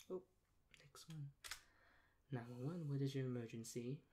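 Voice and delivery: usual speaking voice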